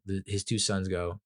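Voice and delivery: ordinary talk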